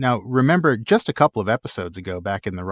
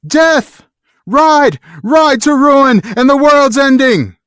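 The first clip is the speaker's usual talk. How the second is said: much higher and louder, slow roaring war cry; battle cry; 13 dB louder, slower than usual. Acted. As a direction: call the host to charge to their doom.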